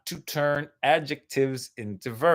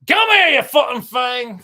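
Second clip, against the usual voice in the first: loud, higher shout of a resentful lift; grumpy lift; 8 semitones higher, 8 dB louder than usual. Pretend. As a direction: bark it like a surly machine. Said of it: "a lift is just".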